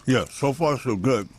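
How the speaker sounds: talking around a mouthful of ice; words muffled by ice in the mouth. Real, not acted. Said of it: "I got the ice in my mouth".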